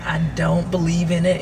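ordinary talk, nothing marked

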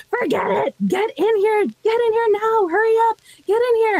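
high, urgent repeated calls. Retold or imitated. Auntie hurrying her niece into the nightclub, increasingly frantic. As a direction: imitate frantic calling, pitched right up and insistent. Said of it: "calling me more frantically".

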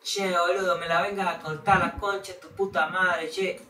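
mock Argentine swearing; Argentine accent. A turn imitating Argentines, mock Argentine accent. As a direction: swear in a put-on rioplatense Argentine lilt.

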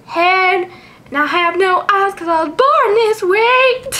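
voicing the Pokemon. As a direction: play it vain and proud of itself. Speaking as the eyeless Pokemon.

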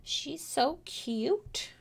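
soft slow high coo. Real, not acted. Artist coos softly over her own character. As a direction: coo fondly at the drawing.

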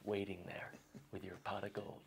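soft, hushed and dreamy; hushed reverie about the pot of gold; mock dreamy hush. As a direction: tell it like a bedtime secret.

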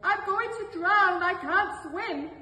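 high, loud, desperate wail; panicked drowning cry. Acted. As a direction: beg for rescue from the water, panicking. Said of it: "cried the wolf".

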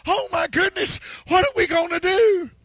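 high, loud dramatic alarm; mock worked-up panic. Whipping others up over hearsay, mock panic. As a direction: play an exaggerating drama queen in a panic. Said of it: "get people all worked up to where they say".

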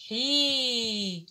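حي held as a long "ḥii"; teacher sounding a long vowel. Shown as a demonstration. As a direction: stretch حي into a long i. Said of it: "أقول له".

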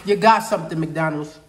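talking between bites; while chewing food. Real, not acted. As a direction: mouth partly full. Seen 1: hand not over mouth while speaking; lips closed, chewing later.

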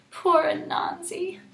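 high crying voice; sniffling. Acted. Animals mourning Anansi, high sniffly cry. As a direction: say it through sniffles. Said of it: "the animals sniffled".

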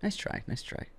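soft unvoiced teasing whisper. Real, not acted. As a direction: whisper it mockingly to the loser. Teasing consolation.

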